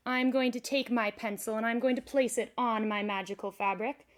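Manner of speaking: loud, projected showman delivery; magician's stage presentation. Acted. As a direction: announce each step to a whole audience. Performs trick as for audience.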